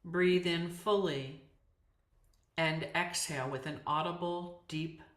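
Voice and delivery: calm voice with long silences between phrases; spacious yoga nidra guidance, sinking toward rest; guided meditation. Acted. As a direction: settle them into stillness, a few words at a time. Guided meditation after the chant.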